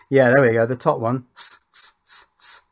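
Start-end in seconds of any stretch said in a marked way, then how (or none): 1.4-2.6 short whispered hissing "S, s" bursts; hissed s sounds. Shown as a demonstration. Testing the top filter channel, hissing "S, s" sibilance. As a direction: hiss into the mic to test the treble channels. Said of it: "responds to the sibilance".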